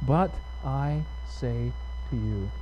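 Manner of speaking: slow, soft, word-by-word repetition; quiet, deliberate quoting for focus; hushed emphasis. Shown as a demonstration. Repeats phrase to focus on it.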